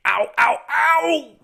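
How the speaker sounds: high, loud cartoon ows; hammed-up hurt cries climbing; mock pained yelps. Peter hurt after landing.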